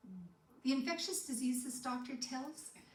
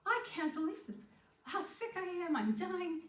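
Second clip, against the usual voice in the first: disbelief; 8 semitones higher than usual. Retold or imitated. Inner thought after divorce threat.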